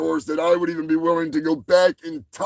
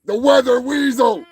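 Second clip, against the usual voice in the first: higher, louder showman intro; booming higher announcement of Willard; announcer call; 5 semitones higher, 5 dB louder than usual. Acted. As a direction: announce him like a game show host. Grand announcement of character.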